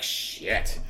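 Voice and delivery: soft, breathy defeated curse; whispered curse. Retold or imitated. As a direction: hiss it out, caught out. Debaters caught out.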